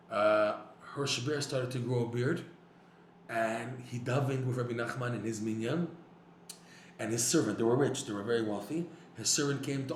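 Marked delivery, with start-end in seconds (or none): none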